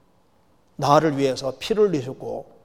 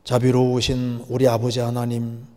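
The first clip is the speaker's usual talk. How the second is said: solemn prayer; 2 semitones lower, slower than usual. Real, not acted. Preacher leading listeners in prayer to the Father, solemn prayer. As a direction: address God in a steady, lowered tone. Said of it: "같이 기도드리겠습니다".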